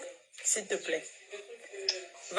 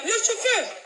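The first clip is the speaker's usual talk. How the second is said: high, raised hail to a driver; calling out; 11 semitones higher, slower than usual. Real, not acted. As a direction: call out to catch the driver's attention. Hailing the driver.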